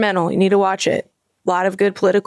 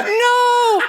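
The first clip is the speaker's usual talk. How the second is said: long, high drawn-out wail; mock despairing cry, stretched out; falling movie character; 13 semitones higher than usual. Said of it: "when he's falling and he's just like".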